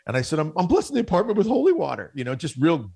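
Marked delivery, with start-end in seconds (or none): 0.4-2.1 high, stammering sheepish voice; husband caught by his wife, meek and much higher; sheepish excuse. Retold or imitated. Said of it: "spiritually sissified".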